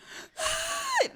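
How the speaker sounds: high startled gasp; sharp gasp. Retold or imitated. Recalling starting to cry in front of someone, a high gasp. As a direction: catch your breath suddenly. Retelling sudden crying moment.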